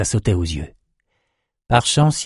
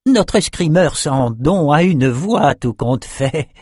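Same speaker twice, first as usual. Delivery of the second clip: mocking; 6 semitones higher, slower than usual. A narrator's reading. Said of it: "railla André".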